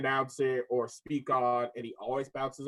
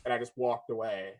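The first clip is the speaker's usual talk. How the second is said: normal talking voice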